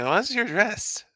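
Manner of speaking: calm psychopath. Acted. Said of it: "flip to serial killer mode".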